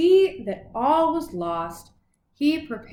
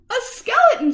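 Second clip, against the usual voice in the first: high, louder terrified scream; 11 semitones higher, 5 dB louder, faster than usual. A narrator's reading. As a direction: scream it as if seeing the dead. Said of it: "screamed another".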